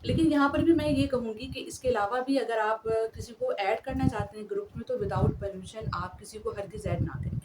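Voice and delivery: ordinary talk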